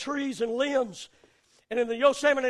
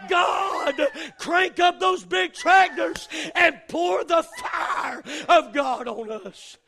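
crying out to God; much slower than usual. Acted. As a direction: cry it out to heaven. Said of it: "cry out and say".

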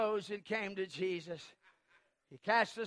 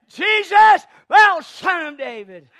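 very high, full-volume shout; crying even louder; 7 semitones higher, 18 dB louder, slower than usual. Acted. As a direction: yell to be heard over everyone. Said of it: "But he cried even louder".

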